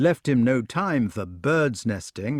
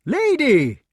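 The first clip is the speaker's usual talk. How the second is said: very high, loud call; 7 dB louder than usual. A narrator's reading. Knight up a tree to the departing lady, calling out. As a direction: call after someone walking away.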